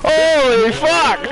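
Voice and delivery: high, sarcastic surprise; mock astonishment. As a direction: feign shock to mock them. Mocking enemies' move.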